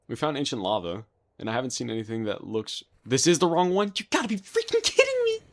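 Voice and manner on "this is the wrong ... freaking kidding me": high, loud exasperated shout; outraged disbelief. Real, not acted. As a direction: yell it in total disbelief. Discovers wrong location.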